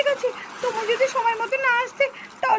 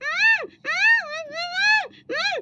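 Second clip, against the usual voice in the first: muffled, desperate hums through a covered mouth; muffled struggling cries; 6 semitones higher, much slower than usual. Acted. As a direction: fight against a hand over the mouth. Mouth held shut, struggling. (মুখ চেপে ধরা গোঙানি)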